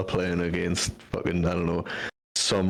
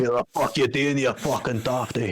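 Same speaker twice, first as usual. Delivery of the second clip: higher, half-hearted mock rage at the driver; play-angry telling off, pitched up; mock raging; 5 semitones higher than usual. Imitating a fallen man raging at the golf cart driver. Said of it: "pure raging".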